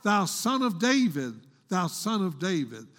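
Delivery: ordinary talk, nothing marked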